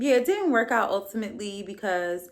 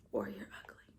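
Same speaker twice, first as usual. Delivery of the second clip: a quick whispered aside under her breath; 15 dB quieter than usual. Real, not acted. Blunt aside she whispers to the audience. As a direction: whisper it like a secret joke.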